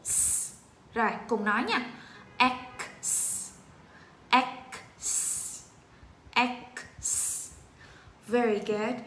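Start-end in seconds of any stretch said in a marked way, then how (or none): 0.0-0.5 "S" as a bare, soft sss sound; just hiss the sound of "S". Shown as a demonstration. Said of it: "Rồi chúng ta xì". 2.4-7.5 "X" as "ek", then a long separate sss; K then hissed S. Shown as a demonstration. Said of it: "xong chúng ta xì S sau".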